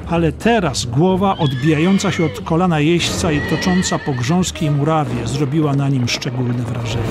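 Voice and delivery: plain reading voice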